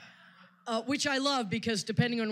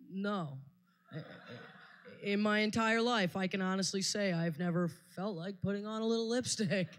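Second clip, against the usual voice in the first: usual speaking voice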